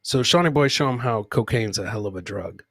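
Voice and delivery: ordinary talk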